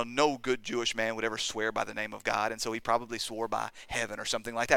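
normal talking voice

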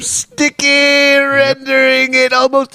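put-on high, drawn-out British accent; hammed-up rising desperation; mounting mock panic. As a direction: build to a high, sustained wail as you read. Hammed-up climax of letter.

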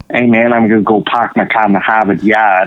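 a hint of Boston accent, pahk the cah style. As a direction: lightly play a Boston local bragging about a beer. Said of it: "It's wicked".

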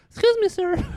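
high, timid little whiny voice; meek and whiny, pleading politely; softy voice. Acted. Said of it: "I got that softy voice".

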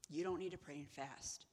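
ordinary talk, nothing marked

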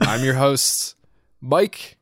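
a dramatic pause before MIKE. Real, not acted. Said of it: "I was pausing for dramatic effect".

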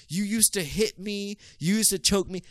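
imitating her whiny accusation; mock accusing girlfriend. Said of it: "started hitting me with the".

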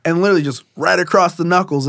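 usual speaking voice

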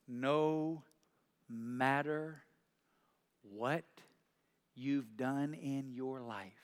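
slow emphasis. Real, not acted. Pastor slowing for emphasis.

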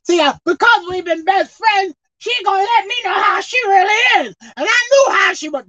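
building sermon delivery; preaching. Acted. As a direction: preach it to the congregation.

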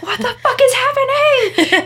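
high, loud re-enacted panic scream; retold scream. Groomer screaming in the car on the way to a client, retold scream. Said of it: "literally screaming in my car".

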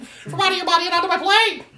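high-pitched squawking Karen; mock outraged hen voice; chicken lady. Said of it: "she looked like a fucking chicken lady".

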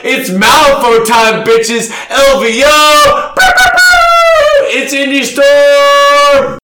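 energy drink ad. Pretend. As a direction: pump up the crowd like an announcer. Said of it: "I'm the hype man".

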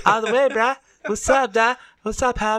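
high, loud mock surfer bro; voice going up. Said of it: "does your voice have to go up when you start talking like that".

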